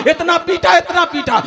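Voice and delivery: normal talking voice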